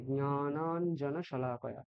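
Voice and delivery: slow জ্ঞানাঞ্জন শলাকয়া, starting on nasal nya; slowly nasalise the opening of জ্ঞানাঞ্জন; Sanskrit pronunciation. Shown as a demonstration. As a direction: model জ্ঞানাঞ্জন as nya-naan-jana, drawn out. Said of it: "ঞকারটা ওখানে আনতে হবে".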